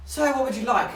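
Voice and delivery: hint of a chirpy server taking orders; waiter. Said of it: "the waiter comes over and goes".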